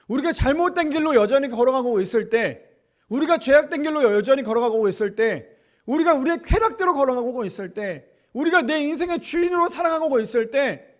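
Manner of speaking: loud, rising preacher's refrain; preaching at full force. Acted. Pastor pressing the congregation, loud preaching. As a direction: drive each clause home to the congregation.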